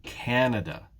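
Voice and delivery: "Canada" drawn out on the first syllable; stress on first syllable. Shown as a demonstration. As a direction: lean slowly on the CAN of "Canada". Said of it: "it's the first syllable".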